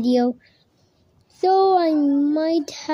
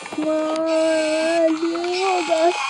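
panicked cry; 3 semitones higher, much slower than usual. Real, not acted. Battery runs out, panic.

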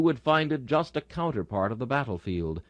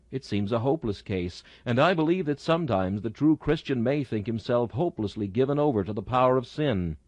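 ordinary talk, nothing marked

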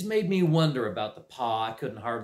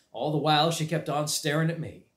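plain reading voice

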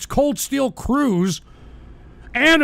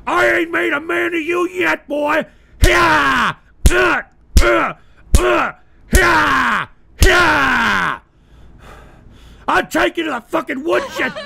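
higher, louder hammed-up stepdad yelling threats; angry stepdaddy; 4 semitones higher, 4 dB louder, slower than usual. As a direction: play a raging stepfather with a belt. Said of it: "if I wasn't your fucking stepdaddy".

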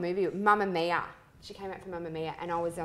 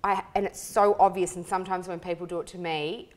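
normal talking voice

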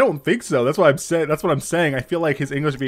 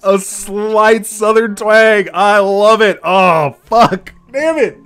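high, loud fan outburst; excited disbelief; 8 semitones higher, 9 dB louder, slower than usual. Real, not acted. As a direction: gush loudly, real delighted shock. Streamer thrilled by character's southern twang.

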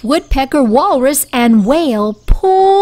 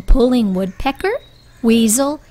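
usual speaking voice

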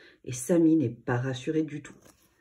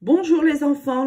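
bright, much higher and louder hello; cheerful greeting; 10 semitones higher, 7 dB louder than usual. A narrator's reading. Dad greeting the children in the morning, cheery call. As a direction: call out joyfully to wake the kids. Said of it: "lance papa joyeusement".

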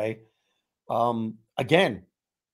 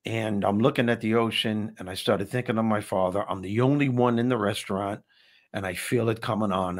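ordinary delivery

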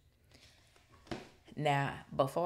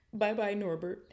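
sobbing; 5 semitones higher than usual. A narrator's reading. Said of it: "Hagrid sobbed".

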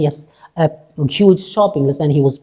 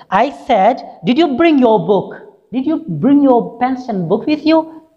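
raised voice for a deaf man; 7 semitones higher than usual. Acted. Clerk repeating to deaf man.

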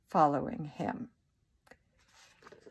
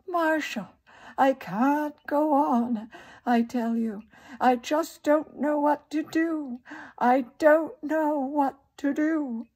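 high, louder, distraught soap-opera heroine; radio melodrama; 8 semitones higher, 4 dB louder than usual. A narrator's reading. Actress on the radio pleads with Marshall, distraught.

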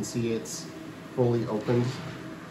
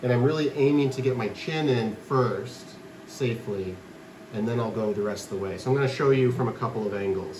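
ordinary delivery

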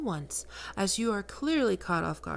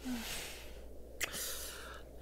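long breathy sigh; 5 semitones higher, 13 dB quieter than usual. Acted. Savouring the listener.

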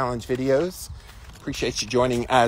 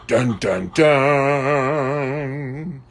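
dramatic sting; much slower than usual. Pretend. Dramatic sting for ice bath.

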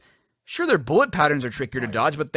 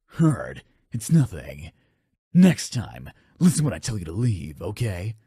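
low gruff warrior voice; 11 semitones lower, 3 dB quieter than usual. Pretend. Undyne voiced low while hurt.

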